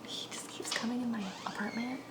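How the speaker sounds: hushed whisper, no voice at all. Real, not acted. Telling viewers about the guy in her apartment, whispering. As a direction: lean in and whisper it like a secret. Confiding about Will coming in.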